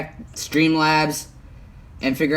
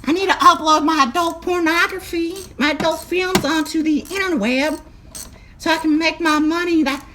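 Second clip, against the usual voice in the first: much higher put-on voice of a clueless old country man; 13 semitones higher than usual. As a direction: play a confused old-timer calling tech support. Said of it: "[name] does multiple voices".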